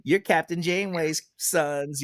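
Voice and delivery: high, drawn-out playground taunt; teasing schoolkids. Retold or imitated. Classmates mocking the captain's children, high drawn-out taunt. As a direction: tease it slowly in a singsong. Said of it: "their other kids in their class would joke".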